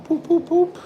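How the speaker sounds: very high squeaky silly chant. Acted. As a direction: squeak it out like a toy. Silly sound effect.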